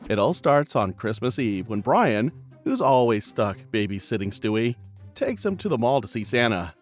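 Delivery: ordinary talk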